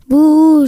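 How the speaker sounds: «bouh» pleurniché et fort; pleurnichement. Acted. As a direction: pleure comme un petit lapin. Le lapin pleure son doudou. (sanglot joué du lapin)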